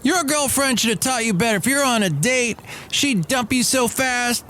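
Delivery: high mocking scold of the coworker. Coworker scolding him for licking his fingers, high mocking voice. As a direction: squeak it out like a nagging coworker. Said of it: "she goes".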